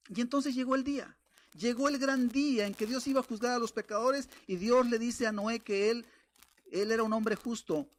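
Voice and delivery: fiery pulpit climax; preaching at full force. Acted. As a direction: proclaim the day of judgment to the congregation. Preacher builds to the judgment day.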